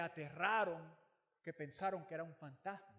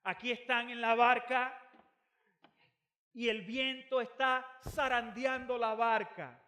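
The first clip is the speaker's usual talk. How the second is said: loud, driving sermon voice; fervent preaching; 6 semitones higher, 9 dB louder than usual. Acted. Pastor drives home the point to the congregation, preaching loudly. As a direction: preach it with full force.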